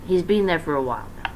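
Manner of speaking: ordinary delivery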